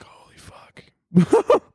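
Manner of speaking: slow, drawn-out whisper; whispered shock. Pretend. Survivor reacting to a crying girl, slow shocked whisper. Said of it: "You're like".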